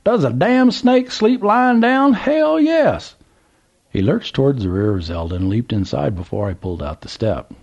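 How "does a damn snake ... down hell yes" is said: put-on high, excited drawl of an old country man; old Southern gentleman. A narrator's reading. Old man calls to trucker, high Southern voice. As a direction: play the curious old man at the gas pump.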